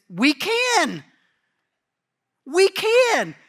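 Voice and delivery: high, loud eager boast. Retold or imitated. Eager loud boast. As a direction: disciples eagerly insisting they can. Disciples eagerly claiming they can.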